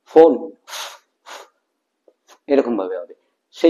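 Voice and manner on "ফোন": "ফোন" with a clear English f; F pronunciation. Shown as a demonstration. Teacher demonstrating the letter F, "ফোন" with an f sound. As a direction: start "ফোন" on an f, lip touching. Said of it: "এফ এর উচ্চারণটা".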